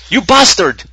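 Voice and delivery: hammed-up furious fan; mock angry Swede. Swedish fan writing in angrily, mock high yell. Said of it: "angry letters from the Swedes".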